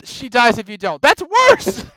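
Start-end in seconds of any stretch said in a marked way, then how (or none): 1.0-1.8 high, loud, appalled; mock outrage. Real, not acted. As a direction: sound scandalised, half laughing.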